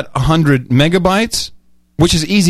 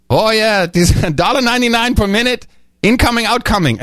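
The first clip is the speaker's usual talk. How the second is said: imitating an Indian call-centre agent, higher voice; mock Indian accent, pitched up; 8 semitones higher than usual. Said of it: "I'm sorry to do the accent".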